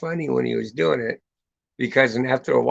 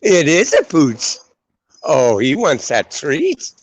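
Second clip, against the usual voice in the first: excited dog talk; 4 semitones higher, 10 dB louder, slower than usual. Real, not acted. Greeting a passing dog.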